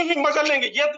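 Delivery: ordinary talk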